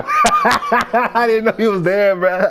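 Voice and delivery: laughing. Real, not acted. Caught talking, laughing.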